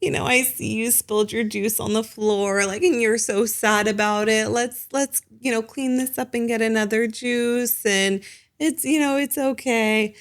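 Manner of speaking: lifted, sweet soothing parent voice; gentle parent voice. Retold or imitated. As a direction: gently coax a child after a spill. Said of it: "the gentle parenting approach might be".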